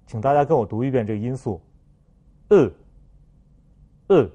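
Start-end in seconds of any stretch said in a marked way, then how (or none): 2.5-4.3 呃 as French eu, lips rounded forward, mouth barely open; closed French vowel. Shown as a demonstration. Teacher models for repetition, 呃 closed and rounded like French eu. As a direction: say 呃 with tongue tip down and front slightly raised. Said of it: "发音的特点是开口度很小".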